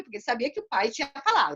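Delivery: normal talking voice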